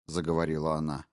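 ordinary delivery, nothing marked

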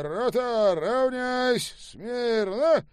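high, loud drill-ground shout; parade command. A narrator's reading. Officer orders the company to attention, commanding shout. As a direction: bellow drawn-out military orders. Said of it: "раздалась команда".

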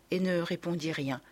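plain reading voice

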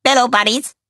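high, loud cheerful Minion greeting. A narrator's reading. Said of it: "begrüßte Kevin sie".

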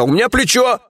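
high-pitched, aggrieved whine; sudden squeal. A narrator's reading. Lieutenant defends himself over his shoulder, squealing. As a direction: shoot the pitch way up in complaint. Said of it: "Вдруг взвизгнул Чеченя".

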